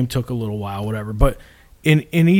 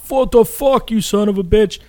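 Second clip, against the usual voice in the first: higher, a milder retold angry yell; imitating dad yelling; 8 semitones higher than usual. Son retells dad cursing during game eight, mildly imitated yell. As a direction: toss off dad's curse at the players. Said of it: "My dad's like".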